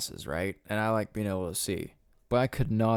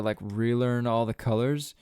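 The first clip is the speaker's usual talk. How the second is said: ordinary talk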